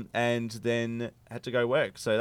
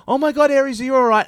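high, loud worried fuss; fussing mum voice; 10 dB louder, faster than usual. Retold or imitated. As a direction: coo over the fallen child. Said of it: "my mum and Emma went".

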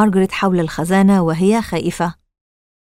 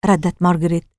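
ordinary delivery, nothing marked

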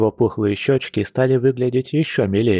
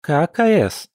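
curious; 7 semitones higher, slower than usual. A narrator's reading. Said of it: "поинтересовалась любопытная Варвара".

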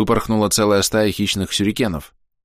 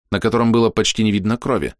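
ordinary delivery, nothing marked

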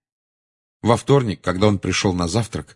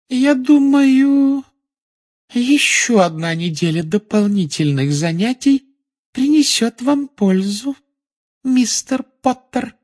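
very high, drawn-out silky menace; insinuating; 5 dB louder, slower than usual. A narrator's reading. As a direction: stretch the words sweetly while punishing. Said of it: "вкрадчиво проговорила Амбридж". (вкрадчиво, высоко, очень медленно)

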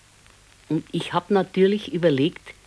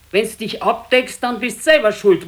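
higher and louder scold; 4 semitones higher, 5 dB louder than usual. Acted. Wife scolds her sick husband, louder and higher. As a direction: tell him off for throwing off the covers.

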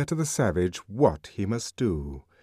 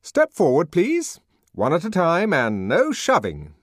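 raised, louder official command; higher-pitched, authoritative instruction; peremptory; 9 semitones higher, 5 dB louder than usual. A narrator's reading. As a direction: order a crowd into line. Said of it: "he said peremptorily".

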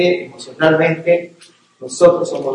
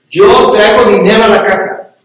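mock proud protest, louder and higher; self-justifying husband; 4 semitones higher, 7 dB louder than usual. Husband excusing himself from childcare, mock indignant. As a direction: boast about your hard work. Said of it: "y decir".

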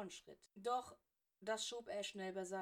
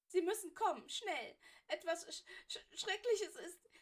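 put-on high, loud, stuttering girl in panic; panicked schoolgirl begging for help; panicked stammer; 10 semitones higher, 7 dB louder than usual. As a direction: push the words out in terror, tripping on them. Granger begs, stammering in panic.

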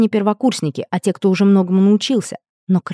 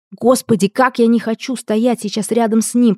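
ordinary delivery, nothing marked

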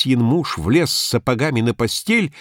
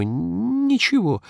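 higher, slow, unsure repeat of ничего; hesitant higher second ничего; 10 semitones higher, much slower than usual. A narrator's reading. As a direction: draw out ничего higher and slower, wavering. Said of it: "нерешительно бросил я".

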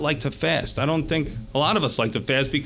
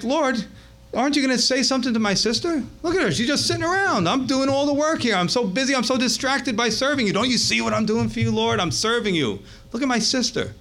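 high-pitched, indignant mock whining; hammed-up exasperation, pitched well up; angry complaint; 7 semitones higher than usual. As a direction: imitate an aggrieved sister demanding backup. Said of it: "she's all angry, and she's like".

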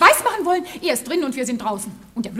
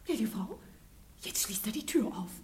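softer, unvoiced hushed whispers; softer conspiratorial whisper; 3 semitones lower, 9 dB quieter than usual. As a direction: whisper so he won't hear. Women sneak into house.

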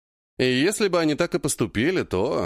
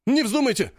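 shout; 10 semitones higher than usual. A narrator's reading. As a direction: yell to stop them now. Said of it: "кричит Богучаров". (высокий громкий окрик)

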